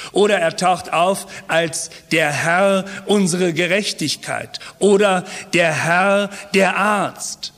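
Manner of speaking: ordinary talk, nothing marked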